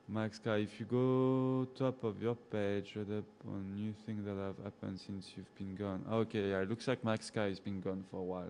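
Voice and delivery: normal talking voice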